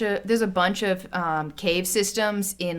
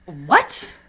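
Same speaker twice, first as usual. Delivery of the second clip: low-pitched, baffled question; a puzzled, disbelieving "what"; baffled reaction; 6 semitones lower than usual. Retold or imitated. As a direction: act out total confusion. Acting out baffled reaction to mishearing.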